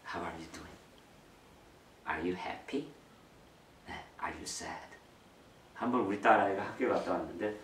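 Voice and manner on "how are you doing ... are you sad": soft, quiet asking; gentle check-in. Shown as a demonstration. Parent gently asking a child, soft and quiet. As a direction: ask your child softly how they feel. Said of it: "개별적으로 아이들에게".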